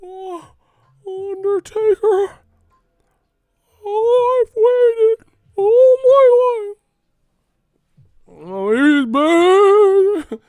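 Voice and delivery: very high, loud, slow, wailing scream; screaming Paul Bearer. Retold or imitated. Mimicking Paul Bearer screaming at Undertaker, very high wailing. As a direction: scream it like a crazed manager. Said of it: "Paul Bearer screaming".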